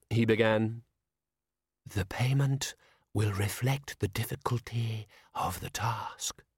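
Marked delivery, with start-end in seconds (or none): 1.9-6.4 slow, whispered toneless spectre; whispering ghost. A narrator's reading. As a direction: breathe it, flat and cold. The spectre speaking.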